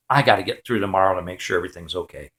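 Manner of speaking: low, soft and quick, to himself; understated anxious self-talk under the voice; worried private thought. Retold or imitated. Scared dad thinks to himself.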